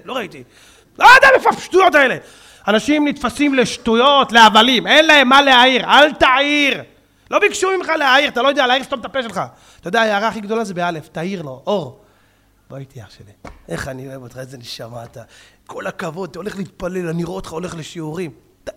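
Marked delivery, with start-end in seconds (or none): 1.0-9.4 loud, fast, scornful rant; sarcastic preacher outburst; indignant shout. Acted. Preacher mocking nitpickers, shouting. 12.7-17.5 soft, low, tender invitation; loving whisper to a young man; gentle coaxing. Shown as a demonstration. Demonstrating the gentle way.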